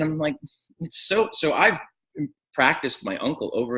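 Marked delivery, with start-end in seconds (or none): none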